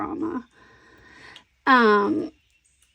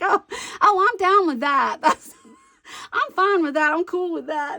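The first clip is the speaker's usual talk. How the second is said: delighted relief; 8 semitones higher than usual. Retold or imitated. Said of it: "And I was like".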